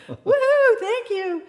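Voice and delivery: very high, loud whoop of thanks; mock elated whoop. Grateful driver shouting goodbye to his guide, elated.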